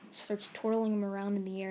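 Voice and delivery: usual speaking voice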